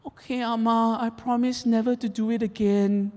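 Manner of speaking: high, sweet innocent promise; the boy promising sweetly while up to mischief; a high little-boy voice promising grandma; imitating son. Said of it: "And he goes".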